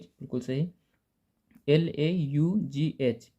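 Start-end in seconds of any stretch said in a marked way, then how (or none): 1.7-3.3 slow, letter by letter spelling. Shown as a demonstration. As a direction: spell each letter out slowly for the class. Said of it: "ये क्या है?".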